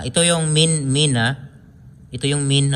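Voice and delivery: ordinary talk, nothing marked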